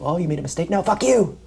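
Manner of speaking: mock the fans who pounce on a mistake; mock gotcha yell. Imitating fickle admirers.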